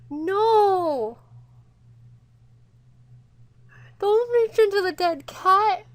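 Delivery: loud, high cry of dismay; distressed high wail; horrified shout. Real, not acted. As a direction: shout in real horror to stop it.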